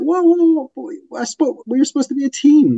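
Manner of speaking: hammed-up betrayed yelp; indignant whoa. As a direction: cry out at a partner who bailed on you. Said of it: "I'd be like".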